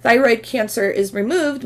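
usual speaking voice

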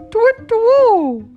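high, loud twit-twoo hoot; loud, high owl call. Acted. Owl's hoot in the story.

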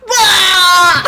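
wailing yelp dissolving into laughter; loud high wail. Retold or imitated. Teacher reliving gagging over the waste bin, loud wail. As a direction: let out a loud panicked wail then laugh.

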